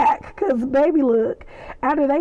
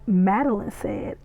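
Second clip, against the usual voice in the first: ordinary talk, nothing marked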